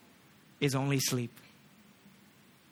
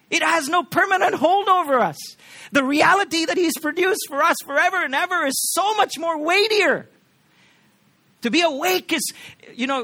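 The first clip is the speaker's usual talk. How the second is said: higher and louder, building and driving; climactic preaching; 7 semitones higher, 4 dB louder than usual. Acted. Pastor rising to the climax for his congregation, loud and high. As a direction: lift the room, soaring and forceful.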